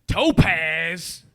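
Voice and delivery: loud, high, hammed-up sassy woman.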